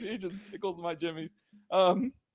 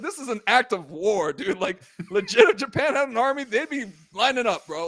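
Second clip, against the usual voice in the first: louder, worked-up declaration of war; mock outrage; 4 semitones higher, 7 dB louder than usual. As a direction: hype it up like it's a real insult. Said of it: "I was like".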